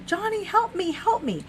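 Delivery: high, pleading mock cry; retold yell. Retelling turning round to John for rescue, mock yelling. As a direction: call out urgently at half volume. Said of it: "And I yelled".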